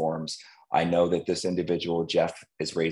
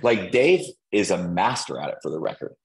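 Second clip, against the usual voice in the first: normal talking voice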